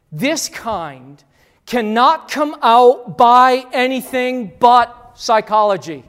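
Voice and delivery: loud, slow mock scripture voice; mocking loud quote. Preacher mocking church reliance on psychology, loud and slow. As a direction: sneer it out like a fake verse.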